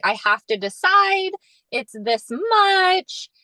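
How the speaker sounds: high, urgent, drawn-out; urgent plea. Retold or imitated. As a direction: press him for an answer.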